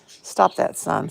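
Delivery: loud sharp telling-off; loud rebuke to her son; scolding. Real, not acted. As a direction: stop the son right now.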